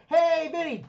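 high, mock-friendly doorstep greeting; cheery call-out. Acts greeting friend at his door.

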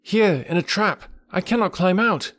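put-on high-pitched trapped boy; boy crying out. A narrator's reading. Trapped boy calling to his rescuers, high cry. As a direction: call out high, like a child needing help. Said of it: "cried Mowgli".

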